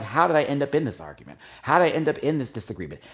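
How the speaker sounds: rapid, rising string of bewildered questions; exasperated self-questioning. Retold or imitated. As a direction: act out asking yourself in disbelief. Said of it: "I'm like".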